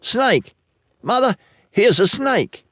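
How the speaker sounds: high-pitched cry of warning; high, urgent child's shout; child's alarmed yell. A narrator's reading. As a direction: call out to mother like a child spotting danger. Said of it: "one of them yells".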